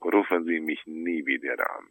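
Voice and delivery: normal talking voice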